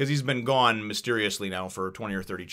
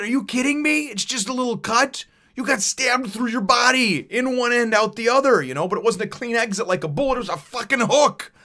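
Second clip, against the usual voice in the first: high, loud outraged disbelief; incredulous rant; 13 semitones higher, 7 dB louder than usual. Pretend. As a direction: shout it as if arguing with a fool.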